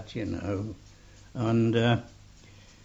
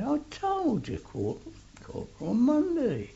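higher, exasperated insistence; exasperated repeat; 13 semitones higher than usual. Retold or imitated. Corporal repeating his name to a pushy stranger, exasperated. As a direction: answer again, fed up.

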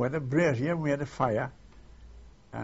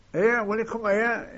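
raised voice, higher and louder, calling across a room; calling over; 7 semitones higher, 6 dB louder than usual. Retold or imitated. Wife calling a stranger over at the club, raised calling voice. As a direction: call him over from across the hall.

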